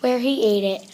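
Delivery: ordinary delivery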